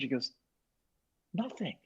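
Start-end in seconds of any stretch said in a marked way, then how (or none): 1.3-1.8 soft, high imitated reply; a small, lifted, hushed answer; hushed wonder. Artist asked what to change, retold in hushed wonder.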